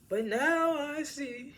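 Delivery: high, slow, drawn-out mock drama; milk the line like a soap opera hero; hammed-up dramatic line. Friend mocking his corny line with a high, slow hammed-up delivery.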